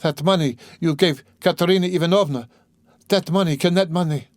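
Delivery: high, urgent dawning suspicion; sudden cry. A narrator's reading. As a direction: blurt it out as a horrified question. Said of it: "she cried suddenly".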